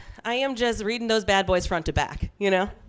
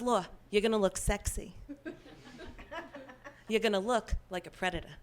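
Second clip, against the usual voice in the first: softer imitated Queens-mom accent; 9 dB quieter than usual. Mom advising her single daughter on outfits, imitated Queens accent. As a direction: do mom's Queens accent, quietly and deadpan. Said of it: "'cause she's from Queens".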